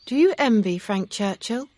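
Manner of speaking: put-on high young woman's voice, lightly probing; Emma, teasing. A narrator's reading.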